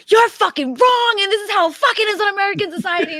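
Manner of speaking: high, loud heated shouting; retold heated argument. Arguing race with Matt in the back room, loud and high. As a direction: yell it at your friend in a debate. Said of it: "and I'm like".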